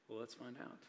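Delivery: coy aside. Acted. Playful aside before reading.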